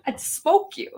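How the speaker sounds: high cackle then a joking boast; playful laughing brag; laughing threat. Real, not acted.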